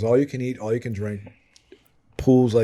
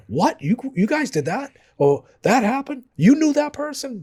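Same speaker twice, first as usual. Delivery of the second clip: climbing higher and higher in shock; each question more stunned than the last; horrified disbelief; 13 semitones higher than usual. Retold or imitated. Outsiders horrified by their stories.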